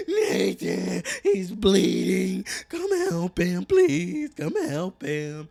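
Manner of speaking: very loud, high, drawn-out wailing plea; acted panicked yelling. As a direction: scream desperately for help, hammed-up. Acted panicked emergency call by wife.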